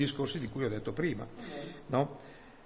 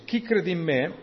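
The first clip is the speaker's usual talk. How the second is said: raised, louder declamatory reading; higher, emphatic Bible reading; crying out scripture; 6 semitones higher, 5 dB louder than usual. Acted. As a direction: proclaim it like a cry to the crowd. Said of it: "Ora Gesù gridò e disse".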